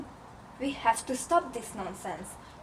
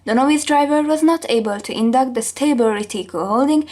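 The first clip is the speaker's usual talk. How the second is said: loud, emphatic account; loud excited witness; 12 dB louder than usual. Acted. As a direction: belt out the crash story. Witness recounts crash loudly.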